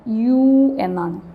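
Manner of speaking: യു said slowly and clearly as "yu", with a y sound at the start; slow pronunciation demo. Shown as a demonstration. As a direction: stretch out യു as "yu" for the class. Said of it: "pronunciation വരുന്നത് എങ്ങനെയാണ്?". (യു എന്ന് സാവധാനം വ്യക്തമായി ഉച്ചരിക്കുക)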